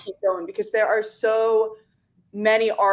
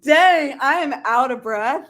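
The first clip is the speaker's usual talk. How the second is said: mock exasperated; 4 semitones higher, 5 dB louder, slower than usual. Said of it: "And I remember thinking".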